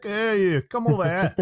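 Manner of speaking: high-pitched mock summons; Jackie Stewart. Imagining marching over to Floyd Mayweather, playful impression. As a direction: call him over in a put-on old man's voice. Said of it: "I want Jackie Stewart to go marching over".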